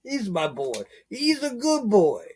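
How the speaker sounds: high, slow mock gangster crooning to the dog. Talking to his dog in a gangster voice. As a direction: talk to the dog like an old movie mobster. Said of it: "You know, like the gangster".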